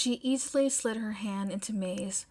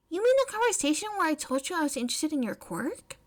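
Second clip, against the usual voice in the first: fast puzzled May; 6 semitones higher, faster than usual. Acted. May answers quickly, puzzled.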